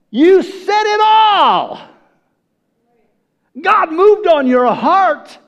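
loud, high, drawn-out exclamation; impassioned preaching. Real, not acted. Preacher exulting at what the church member said, impassioned shout. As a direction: preach it at full force, stretching the words.

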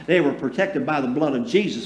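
normal talking voice

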